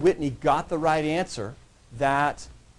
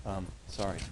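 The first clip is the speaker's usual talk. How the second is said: mumbled sorry under the breath; muttered aside to the class after a joke; quiet apology; 9 semitones lower, 13 dB quieter than usual. Real, not acted. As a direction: drop way down and apologize to yourself.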